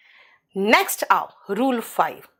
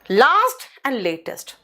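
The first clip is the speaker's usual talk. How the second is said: slow emphasis; much slower than usual. Shown as a demonstration. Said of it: "slow teaching emphasis".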